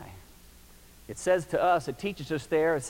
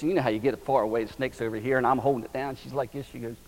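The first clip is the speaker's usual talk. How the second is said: ordinary delivery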